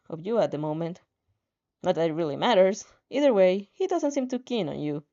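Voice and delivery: plain reading voice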